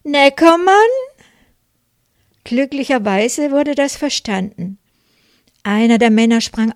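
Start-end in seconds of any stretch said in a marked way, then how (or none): 0.0-0.7 high, loud, pleading question; desperate plaintive call. Retold or imitated. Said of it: "Ich rief verzweifelt in klagend fragendem Ton".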